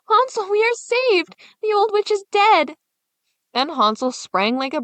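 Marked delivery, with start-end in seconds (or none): 0.1-2.8 put-on high, loud, thrilled girl's shout; joyful cry. Gretel freeing her brother, crying out in high loud joy. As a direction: burst out with the good news. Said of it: "and cried".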